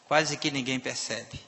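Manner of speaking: ordinary talk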